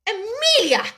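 high-pitched, affronted gasp of the name; scandalised exclamation; infuriated gasp. A narrator's reading. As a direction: gasp it in fury. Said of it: "gasped her infuriated elder".

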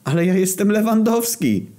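high-pitched outraged outburst; put-out cry of self-importance; indignant exclamation. Acted. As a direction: protest loudly who you are, pitch way up. Indignant player demands recognition.

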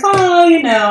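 imitating mom's breezy upbeat tone. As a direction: chirp it like a cheery mom brushing it off. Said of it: "she's real upbeat".

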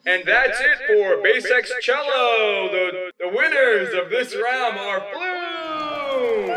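high, loud, drawn-out ring announcer shout; arena announcer. Acted. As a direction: announce the winners to a roaring crowd. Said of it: "And the announcer goes".

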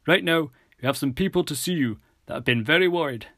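put-on reassuring officer addressing a worried boy; police officer. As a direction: put on an official's lifted, firm voice. Officer Roebuck's voice.

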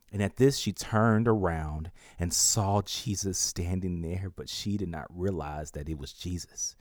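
normal talking voice

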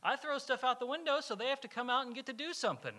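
hammed-up voice of a man sure he's helping; the litterer. Retelling the litterer's prison theory to the youth group, mock earnest. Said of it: "And he's like".

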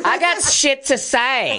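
tipsy swagger. Retold or imitated. Mocking champagne confidence, high and drawn out laughing. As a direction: play a tipsy show-off.

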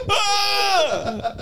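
high, laughing yelp of fright; startled scream, laughing. Retold or imitated. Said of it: "And I'm like".

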